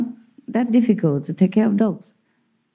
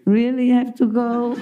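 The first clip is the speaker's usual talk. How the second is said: lightly raised voice, a hint of a sad dog asking; voicing a pleading dog; 6 semitones higher than usual. Dog asking its owner if it must go in, mildly high pleading voice. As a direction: play the dog begging not to go, half laughing.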